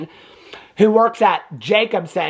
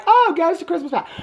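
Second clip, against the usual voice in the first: high-pitched little act of surprise; mock surprise; 9 semitones higher than usual. Heroine meets the Ghost of Christmas Past, mock surprise. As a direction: imitate her startled recognition.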